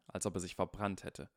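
usual speaking voice

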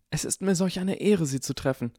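high, star-struck fan greeting; gushing awe. A narrator's reading. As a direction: overflow with honour at meeting him. Harry feigns fan worship to rescue.

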